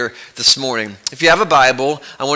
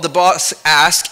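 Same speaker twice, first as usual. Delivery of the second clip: ordinary talk, nothing marked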